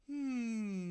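loud high yawning hum; big yawn. A narrator's reading. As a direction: let out a huge yawn.

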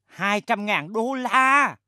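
slow, drawn-out shocked shout; stunned outcry. Acted. Boss shocked at the price, slow stunned shout. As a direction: stretch every word in disbelief.

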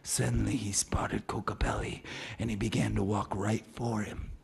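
hushed, tense whisper. A narrator's reading. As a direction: whisper it like danger is near. Suspenseful narration.

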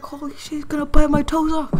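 high, loud panic about his toes; panicked. Retold or imitated. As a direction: squeal it in mock terror.

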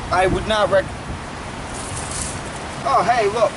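ordinary delivery

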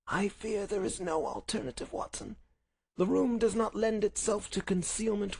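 high, thin sickbed voice; feeble invalid Holmes. Acted. Sick Holmes picks a hiding place for Watson, feeble voice. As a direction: reason it out weakly but precisely. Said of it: "he spoke in a feeble voice".

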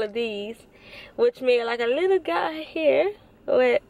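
talking with lip held open; speaking while showing braces; finger pulling lip down. Real, not acted. Seen 3: finger holds lower lip down showing braces, brief release near 1.6.

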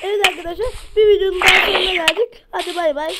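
loud, fast outro pitch; youtuber outro. Acted. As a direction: wrap up like a hyped streamer. Outro announcement. (coşkulu video kapanışı)

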